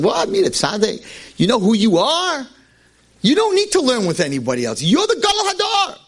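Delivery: a father brushing off his son's wish; mocking father.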